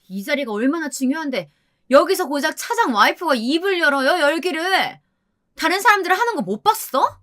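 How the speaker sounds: high, heated telling-off; angry berating. A narrator's reading. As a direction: lay into a junior, pitch climbing sharply. Wife berates narrator for speaking up.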